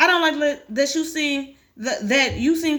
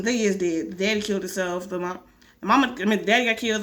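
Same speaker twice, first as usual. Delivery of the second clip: ordinary talk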